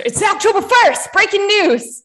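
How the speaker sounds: high, loud breaking-news voice; mock news anchor. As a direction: announce it like a newscaster.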